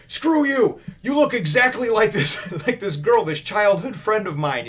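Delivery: high, loud mock outburst; imitated pissed-off yell. Swordsman bickers back at sergeant, mock angry yell. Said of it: "gets pissed off".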